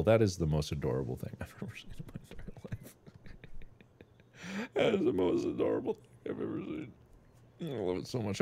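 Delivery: very soft, low gushing; almost whispered, smitten; hushed adoration. Real, not acted. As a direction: gush under the breath. Gushing over the goose's wiggling tail.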